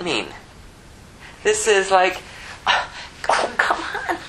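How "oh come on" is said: very high coaxing plea; pleading father. Acted. Father entreating his older son to join. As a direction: beg him to come in.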